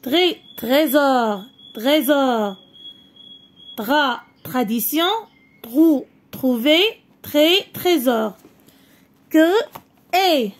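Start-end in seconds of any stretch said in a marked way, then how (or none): 0.0-1.4 slow "tré-" and then "trésor" blended; sounding out syllables. Shown as a demonstration. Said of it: "teacher sounds out syllables". 3.8-8.4 first syllable alone, then the whole word. Shown as a demonstration. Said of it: "teacher sounds out syllables".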